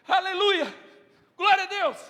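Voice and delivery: high, loud shout of praise; fervent praise. Real, not acted. Preacher praising before the church, loud shout. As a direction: shout it up to God.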